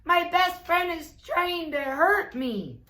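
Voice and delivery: high, loud, hammed-up complaint; mock alarm. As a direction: play the victim, high and loud. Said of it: "cried Toad".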